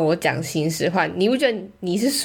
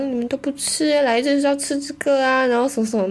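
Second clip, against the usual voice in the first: higher, whiny grumbling friend; mock nagging; 8 semitones higher than usual. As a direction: imitate a sulky friend muttering complaints. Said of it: "就开始那边碎念说".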